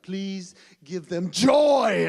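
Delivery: loud, slow, drawn-out plea; dad teasing his boys right before tickling them, loud and slow; playful loud prayer. Retold or imitated. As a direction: boom it out to make the kids squirm.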